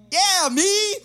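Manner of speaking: a high, loud party whoop; a naive kid who thinks he's being welcomed; excited cheer. Retold or imitated. His own excited reply retold.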